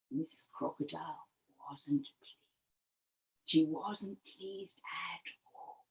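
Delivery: very low, near-whispered storytelling; hushed and ominous; ominous hushed narration. A narrator's reading. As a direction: drop right down, as if warning the listeners. Narrator ominously notes displeasure.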